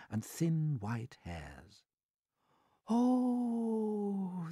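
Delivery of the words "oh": high drawn-out moan; high, long-held "oh" of dread; ladybird's voice. A narrator's reading. Ladybird to the others on seeing strange creatures, high moan. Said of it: "the ladybird said".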